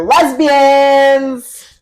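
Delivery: loud, drawn-out excited reveal; excited shout. Real, not acted. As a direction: announce it like big news. Big reveal of the play's twist.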